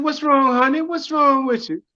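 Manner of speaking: concerned nun. Acted. Nun asking the crying cab driver, concerned. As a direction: coo it high, like a concerned nun.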